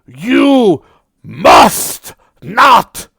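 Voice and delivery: high, booming proud goblin shout; a proud goblin bellows a warning; goblin's loud warning. Acted. Goblin shouts warning to the party, high and booming. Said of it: "he repeats".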